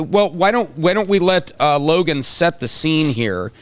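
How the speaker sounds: ordinary talk, nothing marked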